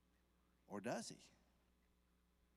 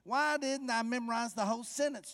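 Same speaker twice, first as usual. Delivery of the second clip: loud, high and slowing at the end; fervent preaching; 9 semitones higher, 9 dB louder than usual. Acted. Preacher lamenting the unmemorized verse to the church. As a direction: plead it out, stretching the last words.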